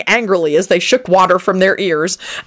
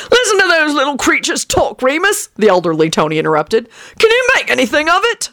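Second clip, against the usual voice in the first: put-on eccentric old man; 8 semitones higher than usual. A narrator's reading. Old man bragging to Lupin, high boastful voice. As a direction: puff yourself up like a dotty old sailor.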